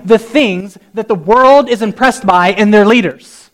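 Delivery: loud, slow, emphatic preaching; full-force preaching. Real, not acted. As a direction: preach it out, hammering each phrase.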